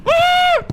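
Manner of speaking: sudden high shriek of fright; startled scream. Real, not acted. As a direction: yelp in panic. Ghost hunt starts, startled.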